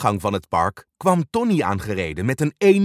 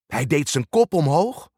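usual speaking voice

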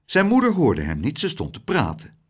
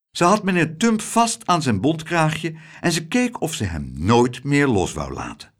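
ordinary delivery

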